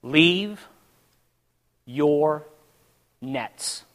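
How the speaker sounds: one word at a time; long pauses between words, softening to the end; voice of God. Retold or imitated. God commanding a man, slow and deliberate. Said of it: "And he says".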